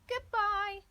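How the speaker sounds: a second voice calling "Goodbye" back; a high echoing reply of "Goodbye"; other child's goodbye. A narrator's reading.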